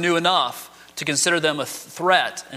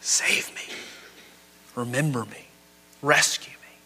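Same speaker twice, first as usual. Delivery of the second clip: a soft, breathless whisper, begging; whispered plea; 5 semitones lower, 6 dB quieter than usual. Retold or imitated. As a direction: whisper the plea as if dying on the cross. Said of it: "He calls out to Jesus".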